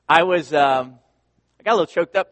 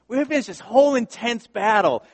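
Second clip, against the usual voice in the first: usual speaking voice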